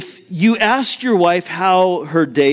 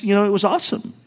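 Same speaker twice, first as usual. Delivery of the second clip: usual speaking voice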